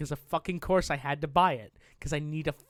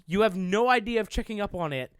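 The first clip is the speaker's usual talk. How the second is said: raised-pitch rant, heavily stressed; indignant; 4 semitones higher, 4 dB louder than usual. Retold or imitated. Car owner to sister about inspections, indignant. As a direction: protest it with punched key words.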